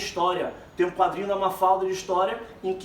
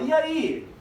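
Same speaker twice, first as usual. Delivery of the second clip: little friend's question; 9 semitones higher than usual. Acted. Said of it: "Miguelito, fala".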